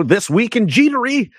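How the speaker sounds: slow, high mock show-host voice; mock announcer. Host mocking a news segment title, slow and high.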